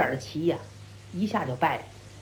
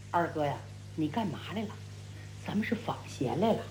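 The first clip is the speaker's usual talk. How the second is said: low, soft reminder; calm him down in a low, hushed voice; quiet admonishing; 10 semitones lower, 12 dB quieter, faster than usual. A narrator's reading. Brother reminds the brawler of their mission, quiet and low. Brother soothes the angry man.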